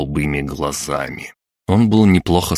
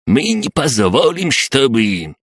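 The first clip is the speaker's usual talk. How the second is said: put-on very high, indignant old-man outburst; threatening old man; 8 semitones higher, 3 dB louder, slower than usual. A narrator's reading. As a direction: advance on them with an old codger's threat. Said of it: "начал с угрозой надвигаться". (угрожающий возмущённый старикан, очень высоко)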